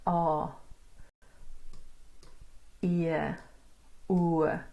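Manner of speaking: "A", "E", "U" each held long and separately; long vowel sounds. Shown as a demonstration. Teacher letting learners hear the sounds, long "A, E, U". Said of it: "These are all long sounds".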